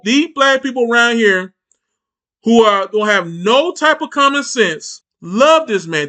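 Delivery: ordinary talk, nothing marked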